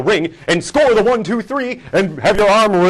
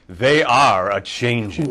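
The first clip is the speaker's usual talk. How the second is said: villain proclamation; 6 semitones lower, much slower than usual. Acted. Heel promo declaration.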